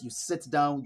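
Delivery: usual speaking voice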